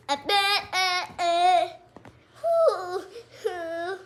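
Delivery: high loud whimpering wail; crying out. Pretend. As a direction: wail out in pretend distress. Crying out during play.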